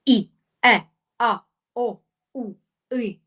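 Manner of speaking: each vowel pushed out hard from the muscles; short forceful vowel drills; punchy vowels. Shown as a demonstration. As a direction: punch every vowel into one point. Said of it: "м'язами виштовхую ці звуки ... в одну точку б'єте".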